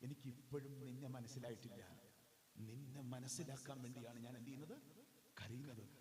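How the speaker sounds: understated sorrow, low and soft; lightly acted plea with tears held back; hushed, mildly sorrowful confiding to God; tearful-eyed Kunti. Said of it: "നിറമിഴികളോടെ കൃഷ്ണനെ നോക്കിയിട്ട് പറയുന്ന".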